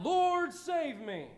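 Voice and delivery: cry out. Acted. Cry out in trial.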